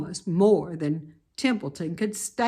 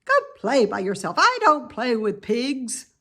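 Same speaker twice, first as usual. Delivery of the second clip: louder, quick, curt lamb voice; snooty lamb; 5 semitones higher, 7 dB louder than usual. Acted. Lamb rejecting Wilbur, loud and curt. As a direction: shut him down fast. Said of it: "said the lamb".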